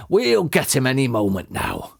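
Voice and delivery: ordinary delivery, nothing marked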